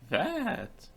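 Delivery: sudden crack way up in pitch; voice cracking up an octave. Real, not acted. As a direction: voice breaks high on one word. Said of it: "my voice broke".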